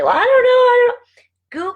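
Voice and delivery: high, loud mocking whine; whiny mock excuse. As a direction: mimic a lame excuse, way up high. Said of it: "I know you're gonna say".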